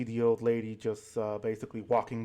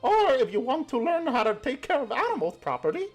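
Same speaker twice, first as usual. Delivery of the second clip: put-on old granny voice. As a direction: coo like a doting grandma pinching cheeks. Granny welcomes group to farm.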